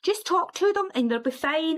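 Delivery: high, gentle reassuring voice; soothing Catherine. Retold or imitated. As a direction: coax like a calm friend. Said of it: "she would be like".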